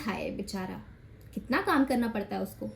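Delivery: low, soft pitying voice; mock sympathy. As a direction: imitate a family's soft, sorry sympathy. Acting out family's pity for men. (बनावटी हमदर्दी भरी धीमी आवाज़)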